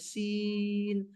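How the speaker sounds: "سین" held long and level; elongated letter name. Shown as a demonstration. Teacher reciting Arabic letters, "سین" drawn out long. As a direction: stretch the middle vowel of "سین" steadily. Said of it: "لمبا کرنا ہے".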